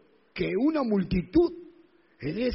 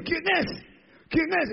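blind man asking; 8 semitones higher than usual. Acted.

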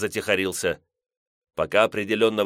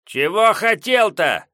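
high, slow cranky voice from indoors; grumpy old man; 11 semitones higher, 7 dB louder, slower than usual. Acted. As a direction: squawk it like a cranky peasant. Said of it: "сварливый голос".

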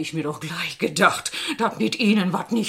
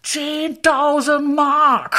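high, slow and louder; savouring the sum; 10 semitones higher, 5 dB louder, much slower than usual. Acted. Old seamstress boasting to the visitor, slow savouring. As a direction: relish every word of the sum.

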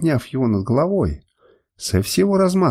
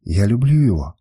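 usual speaking voice

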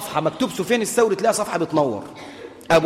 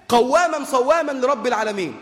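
ordinary delivery

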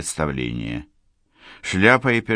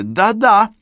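much higher, louder triumphant jab; pressing on; 6 dB louder than usual. Acted. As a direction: drive the point home. She presses the attack.

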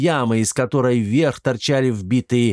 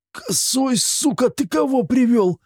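rasping it out much higher, straining; strained wheeze; 13 semitones higher than usual. A narrator's reading. Captive bandit rasps at Kosoy, strained wheeze. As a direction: squeeze the words out while pinned by magic. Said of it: "с трудом прохрипел".

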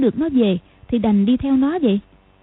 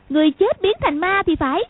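put-on little girl voice; 7 semitones higher than usual. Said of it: "Lục Vô Song nói".

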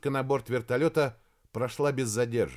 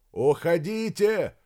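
higher, drawn-out shout; shouting back; 5 semitones higher, 4 dB louder, slower than usual. A narrator's reading. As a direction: call back slowly and firmly. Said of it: "крикнул в ответ Максим". (крикнуть в ответ)

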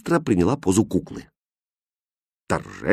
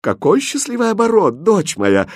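high, delighted exclamation; bright burst of joy; joyful; 9 semitones higher than usual. A narrator's reading. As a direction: gush with relief to your daughter. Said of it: "радостно воскликнула королева".

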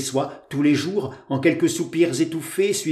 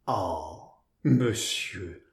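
soft, low sulky reproach; quietly sulky, mock stern; pouting; 6 semitones lower, 7 dB quieter, much slower than usual. A narrator's reading. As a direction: scold him with a pout. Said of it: "lui dit-elle boudeuse en le menaçant du doigt".

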